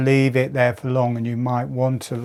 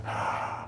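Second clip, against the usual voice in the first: soft open-mouthed breath out; big mouth-breathing sigh; demo sigh; 14 dB quieter than usual. Shown as a demonstration. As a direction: let the breath fall out through the mouth. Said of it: "Just go".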